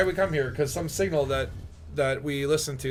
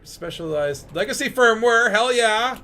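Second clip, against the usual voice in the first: pleased, higher and louder cheer; delighted gamer reaction; excited find; 7 semitones higher, 4 dB louder, slower than usual. Real, not acted. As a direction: celebrate a lucky find, pitch lifted. Finding loot in the game.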